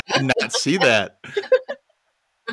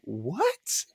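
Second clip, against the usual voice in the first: stunned disbelief; 8 dB quieter than usual. Retold or imitated. Acted stunned reaction to the story.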